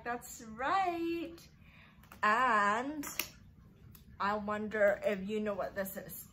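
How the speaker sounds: put-on bright, sing-song talk for little kids; preschool teacher voice. Teacher to her class, sing-song and coaxing. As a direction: coax small children along. Teacher talking to young children.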